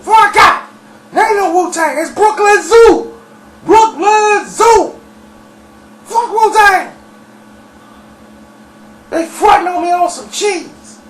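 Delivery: high, loud, hollering rant; mad outburst. Retold or imitated. Dirty going off on Wu-Tang over money, mad hollering. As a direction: yell the slogans, stretched out and furious. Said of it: "he was mad at Wu-Tang".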